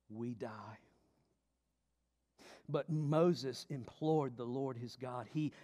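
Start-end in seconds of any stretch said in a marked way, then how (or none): 0.1-0.8 whispered. Acted. Preacher repeats line for effect.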